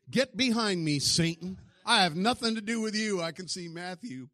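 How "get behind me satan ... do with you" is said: loud, high rebuke to Satan; stern rebuke. Retold or imitated. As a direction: cast him off with a loud rebuke. Jesus rebuking Peter.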